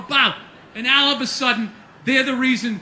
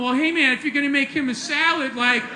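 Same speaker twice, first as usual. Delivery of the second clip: pothead. Acted. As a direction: complain like an aggrieved pothead. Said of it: "All the potheads showed up going".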